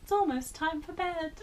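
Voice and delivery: high, loud hammed-up posh mum; laughing posh mum imitation; mock posh mum. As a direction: parrot the posh mum line. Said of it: "posh voice".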